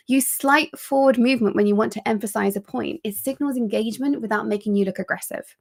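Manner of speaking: usual speaking voice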